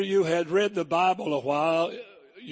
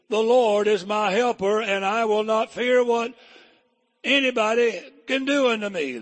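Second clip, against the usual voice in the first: louder preacher declaration; bold proclamation; 5 semitones higher, 5 dB louder than usual. Acted. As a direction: say it boldly, unafraid.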